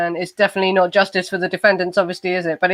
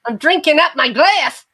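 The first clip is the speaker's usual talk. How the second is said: mock obedient; 8 semitones higher, 4 dB louder than usual. Mock obeying after scolding.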